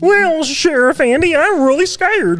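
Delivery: high, slow mock-scared drawl; scared deputy voice. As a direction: whine it like a frightened small-town deputy. Imitating Mayberry characters.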